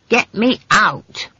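imperious demand. A narrator's reading. Trapped witch ordering hunters to free her, imperious. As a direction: space the words out as a command.

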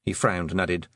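usual speaking voice

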